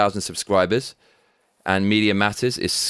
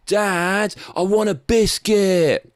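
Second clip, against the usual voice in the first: put-on higher, slow English kid asking dad; 8 semitones higher, much slower than usual. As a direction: plead for a biscuit in English. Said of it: "We say".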